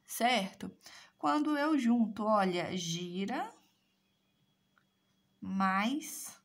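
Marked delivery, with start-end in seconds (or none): 2.8-6.4 word parts spelled out. Shown as a demonstration. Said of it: "eu tenho girassol".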